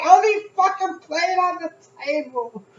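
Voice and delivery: very high, drawn-out mock shout; exasperated yell. Answering mom about the one plate, exasperated mock yell. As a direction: stretch it into a loud, high retort.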